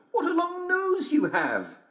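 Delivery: put-on very high, girlish and bright; little girl voice.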